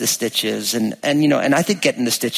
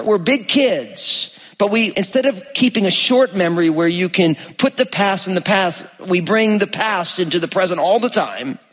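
raised, higher preaching voice, driving the point; 6 semitones higher than usual. Acted. Pastor urges the church to keep a short memory, preaching with force. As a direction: lift it up and preach to the congregation.

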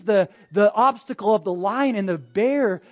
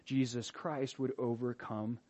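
much softer, lower closing words; hushed reflection; 6 semitones lower, 12 dB quieter than usual. Real, not acted. Preacher closing the teaching to the church, hushed. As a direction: draw them in close and quiet.

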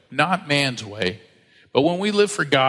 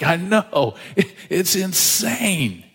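laughing disbelief; 4 semitones higher, much slower than usual. Retold or imitated. He laughs telling wife.

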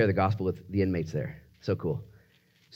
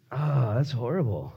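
acted dismay; slower than usual. Acting dismay at Lazarus dying.

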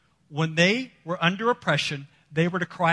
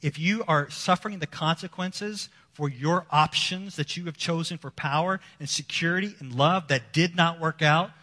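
ordinary delivery

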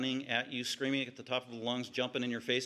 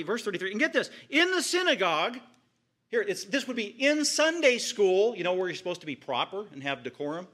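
full-force preaching; 6 semitones higher, 5 dB louder than usual. Real, not acted. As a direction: cry out the shocking scene.

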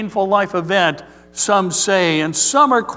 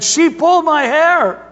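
put-on high, loud whiny child voice; hurt little boy; 9 semitones higher, 4 dB louder than usual. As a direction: complain like a hurt five-year-old. Screaming hurt boy complains to mom.

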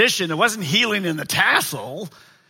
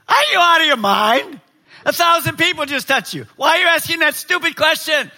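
high, loud, exasperated outburst; incredulous Peter; 11 semitones higher, 5 dB louder than usual. Acted. Preacher as a disciple challenging Jesus in a crowd, incredulous. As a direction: scold him as if he's lost his mind. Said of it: "Peter wouldn't say it like that".

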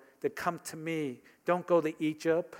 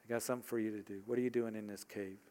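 hushed storytelling; 4 semitones lower, 5 dB quieter than usual. A narrator's reading. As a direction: lower and much softer, drawing listeners in. Quiet retelling of the Elijah story.

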